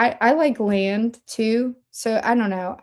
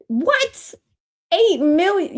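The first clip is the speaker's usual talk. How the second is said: disbelieving surprise; 8 semitones higher, slower than usual. Real, not acted. Reacting to chat's huge number.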